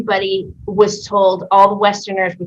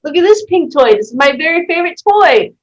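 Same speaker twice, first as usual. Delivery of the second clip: mock shop pitch, much higher and bright with excitement; salesy enthusiasm; 8 semitones higher, 5 dB louder than usual. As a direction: gush over the toy like a saleswoman. Pushing a toy on the psychic.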